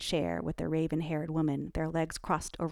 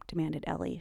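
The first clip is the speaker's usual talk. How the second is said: ordinary delivery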